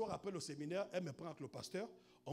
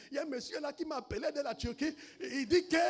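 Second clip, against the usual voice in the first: higher-pitched imitation in a Cameroonian accent; 6 semitones higher, 9 dB louder than usual. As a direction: imitate her thick Cameroonian way of reporting it. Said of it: "avec l'accent camerounais". (imitation avec l'accent camerounais)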